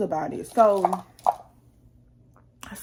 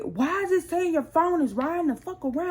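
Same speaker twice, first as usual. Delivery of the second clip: higher, pointed demand; retold angry demand; 8 semitones higher than usual. Confronting husband over his phone location, a higher, sharp demand. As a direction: fire the question at him.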